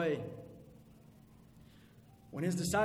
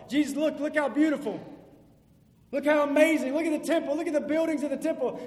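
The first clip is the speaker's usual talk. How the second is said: high, loud, eager gushing; excited disciples; 8 semitones higher, 7 dB louder, faster than usual. Acted. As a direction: point and marvel like an awestruck tourist. Disciples voiced pointing at temple.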